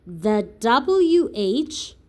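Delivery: ordinary talk, nothing marked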